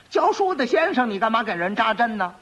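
high-pitched disbelieving question; incredulous magistrate. Acted. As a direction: challenge the lie, starting high. Incredulous magistrate challenges the lie.

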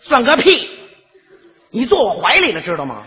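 mock scolding. Bus woman scolding the young man, mock angry.

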